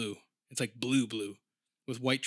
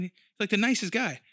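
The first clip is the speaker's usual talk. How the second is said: ordinary delivery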